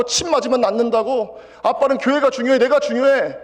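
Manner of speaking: loud, high, furious outburst at father; angry shouting son. Retold or imitated. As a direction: shout it out like a hurt son demanding to matter. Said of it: "그렇게 말해야지". (다리 부러진 아들이 아빠에게 화내며 소리치듯)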